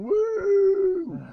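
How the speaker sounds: high, loud woo; mock kid cheer. Mocking nine-year-old kids after playing badly, high whoop. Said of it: "but just going".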